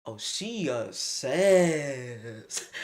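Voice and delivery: mocking classmate. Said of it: "the messy girl go".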